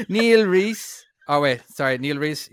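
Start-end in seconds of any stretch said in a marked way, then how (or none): 0.1-1.0 higher, louder put-on Dublin, lifted ending; hammed-up Dublin accent, pitched up. Host reading a supporter's name, exaggerated Dublin accent. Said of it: "let's try a Dublin accent".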